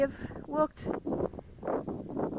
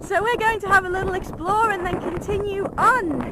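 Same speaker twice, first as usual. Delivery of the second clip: shouting over wind; 8 semitones higher, 10 dB louder than usual. Real, not acted. Windy outdoors.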